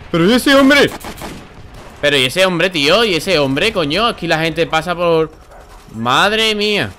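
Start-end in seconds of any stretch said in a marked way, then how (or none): 0.1-0.9 high, loud astonished cry; shocked shout. Real, not acted. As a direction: yell in disbelief. Surprise at an enemy. (grito de sorpresa)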